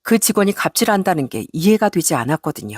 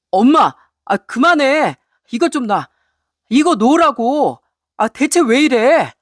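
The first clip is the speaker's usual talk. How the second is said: raised, exasperated son's protest; urgent intervention; 5 semitones higher, 3 dB louder, slower than usual. Acted. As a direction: plead with mother to stop, drawing out the last demands. Husband breaks up the fight. (다급하게 엄마를 말리는 아들)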